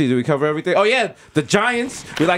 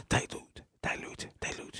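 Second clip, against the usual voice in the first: hushed, voiceless whisper; 18 dB quieter, slower than usual. Pretend. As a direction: whisper it like a secret refrain. Hushed refrain in the nickname list.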